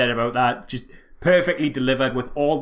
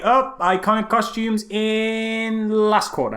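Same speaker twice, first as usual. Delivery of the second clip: mock announcement; 8 semitones higher, slower than usual. Mocking the film's late costumes.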